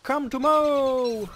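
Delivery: loud, high, slow calling out; excited calling. Acted. Gamer calling out excitedly to a beefalo. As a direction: call out to the beefalo, thrilled.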